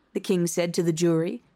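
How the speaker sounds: plain reading voice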